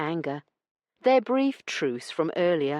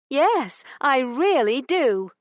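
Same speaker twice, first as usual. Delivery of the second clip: high, loud, slow insistence; defiant lady; 10 semitones higher, 6 dB louder, slower than usual. A narrator's reading. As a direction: dig in defiantly. Heroine insists.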